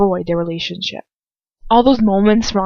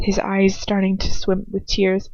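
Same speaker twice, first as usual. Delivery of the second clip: plain reading voice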